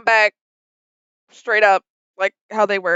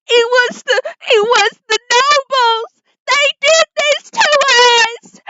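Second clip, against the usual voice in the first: very high, slow, wailing hysteria; hysterical horror; 13 semitones higher, 4 dB louder, slower than usual. Acted. Revived lady accusing the nobles, hysterical wailing. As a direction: wail each phrase in drawn-out, acted panic. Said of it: "and then she's like".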